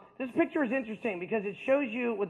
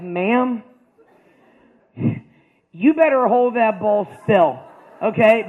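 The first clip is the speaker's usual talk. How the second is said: louder, slower, urgent command; mock warning shout; 9 dB louder, slower than usual. Warning the nurse to hold the bowl still, loud and urgent. Yelling at nurse while peeing.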